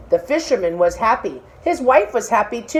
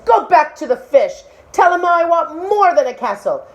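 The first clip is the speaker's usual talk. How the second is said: high, louder bossy wife; demanding wife; 8 semitones higher, 4 dB louder than usual. Acted. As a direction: order him off to the sea.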